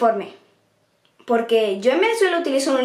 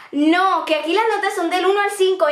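higher, emphatic retold correction; father's correction; 6 semitones higher than usual. Father corrects daughter about the grading scale, emphatic. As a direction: imitate a parent firmly putting a child right.

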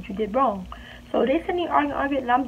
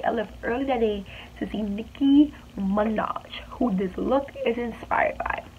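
whispered aside; 6 dB quieter than usual. Real, not acted. Confiding aside to viewers.

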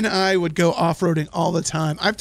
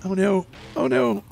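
oh no building higher; growing panic, higher each time; rising alarm; 7 semitones higher than usual. Real, not acted. Rising alarm watching truck crash.

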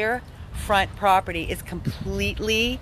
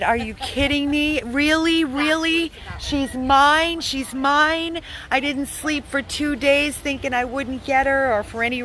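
higher, imitating a thrilled new owner; retelling how Melinda reacted to passing: higher, excited disbelief; 6 semitones higher than usual. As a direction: relay her joyful shock, lightly acted. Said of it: "She started crying, and she said".

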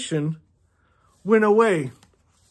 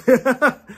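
laugh; 4 semitones higher, 3 dB louder than usual. Real, not acted. Laughing at Scout.

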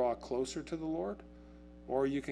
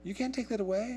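put-on higher, whiny kid insisting; a pitched-up player defending the win; teen pleading "we won"; protesting kid; 10 semitones higher than usual. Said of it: "You're going".

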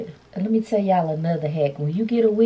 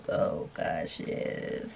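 breathy aside; 13 semitones lower, 10 dB quieter, much slower than usual. Real, not acted. Delighted at wig fit.

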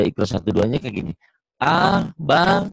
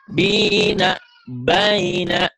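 bold model reading; 6 semitones higher, much slower than usual. Shown as a demonstration. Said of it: "Jadi itu tuh bacanya".

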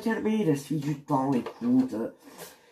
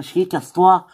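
angry; 4 semitones higher, 9 dB louder than usual. Acted. Telling intruder to get lost.

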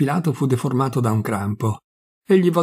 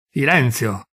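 ordinary delivery, nothing marked

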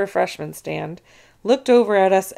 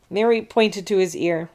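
ordinary delivery

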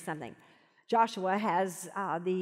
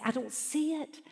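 frustrated complaint; 6 semitones higher, 5 dB quieter than usual. Retold or imitated. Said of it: "'cause I'll say".

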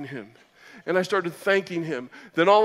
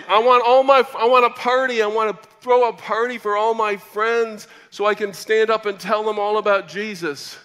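excited; 5 semitones higher, 7 dB louder than usual. Retold or imitated. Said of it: "I said".

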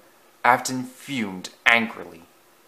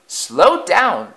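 higher, louder plea; wailing; 8 semitones higher, 4 dB louder than usual. A narrator's reading. Old coaches begging Afton to slow, wailing. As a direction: cry out in fright. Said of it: "they wailed".

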